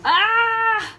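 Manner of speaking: high, loud sudden cry; startled yelp. Real, not acted. Host startled while drawing the winner's name. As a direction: yelp in surprise.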